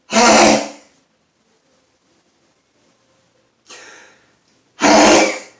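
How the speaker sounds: big explosive sneezes; loud sneezes. Real, not acted. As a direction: sneeze hard, one after another. Filming an afternoon sneezing fit.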